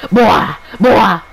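a put-on stern dad shouting boy; mock Kratos shout. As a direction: yell it like an angry warrior father. Imitating Kratos barking at son.

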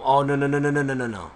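rapid-fire run of panicked "no"s; comic panic. Real, not acted. Gamer about to die to cows, comic panic. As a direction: blurt it loudly in one rattled string. Character nearly dying to cows.

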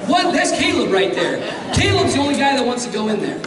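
high, fast, laughing praise; laughing shout. Real, not acted. Excited shout about Caleb.

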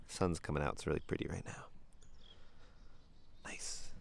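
soft, near-whispered wonder; hushed awe. Real, not acted. Admiring the creek as the sun comes out, hushed. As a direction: murmur it as if not to spoil the view.

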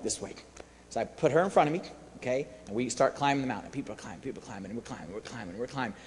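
low, soft, plodding narration; quiet, low-pitched trudging repetition; hushed storytelling. Retold or imitated. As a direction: drop low and soft as the climb drags on. Hushed retelling of the climb.

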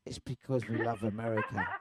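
low, deadpan joking declaration; mock patriotic answer. English guest explains why singers lose their accent, mock earnest.

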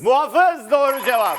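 loud, high celebratory shout; coşkulu doğru cevap anonsu. Real, not acted. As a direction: shout the winning answer to the studio. Host calls out correct answer. (coşkuyla, yüksek sesle bağırarak)

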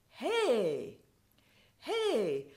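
lilting, joyful hey from across the car; joyous lilting call. Retold or imitated. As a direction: call out like you just found what you were looking for. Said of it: "It had a joyous, lilting quality".

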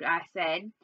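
ordinary delivery

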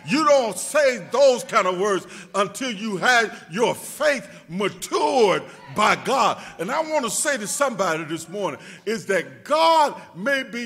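loud, rising preacher's whoop; preaching at full force. Acted. Preacher shouting faith to the congregation, full force.

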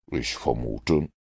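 low, slow, rueful Holmes; a dry detective mildly regretting his deduction. A narrator's reading. Holmes to Mortimer, low and rueful.

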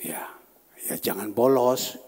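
ordinary talk

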